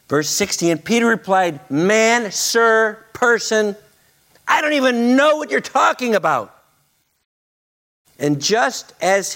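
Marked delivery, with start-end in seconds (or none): 1.7-6.6 flustered denial. Acted. Peter's flustered denial voiced.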